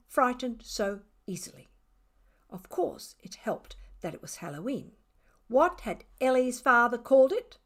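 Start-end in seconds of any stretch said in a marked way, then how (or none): 2.5-4.9 hushed whispered aside; spooky whisper. A narrator's reading. Narrator whispering a Halloween aside to listeners. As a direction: breathe it like a ghost story secret.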